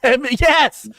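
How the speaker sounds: high, loud delighted outburst; excited recognition. Real, not acted. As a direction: burst out as the reference clicks. Delighted at recognizing a show reference.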